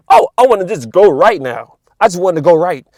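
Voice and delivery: a hint of a distracted shopper; mock impulsive thought. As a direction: act out someone's sudden whim. Voicing a possessed person's thought.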